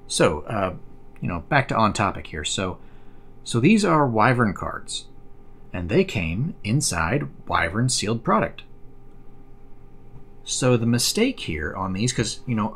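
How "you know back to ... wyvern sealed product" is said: usual speaking voice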